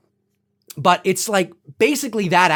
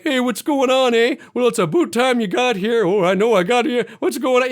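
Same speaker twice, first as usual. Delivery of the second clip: exaggerated Canadian accent; 4 semitones higher than usual. Mocking how a movie voices Canadians, exaggerated Canadian accent. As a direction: put on a hammed-up cartoon Canadian accent. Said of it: "in that movie, they're like".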